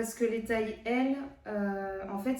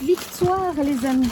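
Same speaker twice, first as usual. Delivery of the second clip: excited; 5 semitones higher, 9 dB louder, slower than usual. Real, not acted. Triumphant after getting the parcel.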